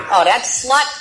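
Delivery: crude puppet voice, cackling; squeaky ventriloquist dummy. Acted. Ventriloquist act, dummy speaking.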